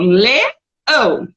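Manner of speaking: LEÃO broken in two, le... ão; teacher sounding out "leão" in two beats; split into syllables. Shown as a demonstration. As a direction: say "leão" syllable by syllable, pausing between. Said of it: "Duas sílabas".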